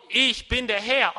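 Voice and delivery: loud, emphatic divine statement. Acted. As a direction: declare it firmly as God. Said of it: "hier wird Gott lauter".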